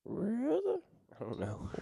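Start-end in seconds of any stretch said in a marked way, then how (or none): 0.1-0.6 high, squeaky gremlin voice. Pretend. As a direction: chirp it like a little gremlin. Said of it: "Sounds like a gremlin".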